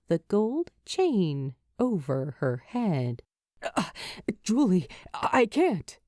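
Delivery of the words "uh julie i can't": stammering. Acted. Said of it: "he stammers".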